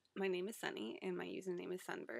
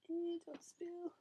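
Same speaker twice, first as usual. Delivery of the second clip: high squeaky warning; 10 semitones higher, much slower than usual. Real, not acted. Note to self, full tea.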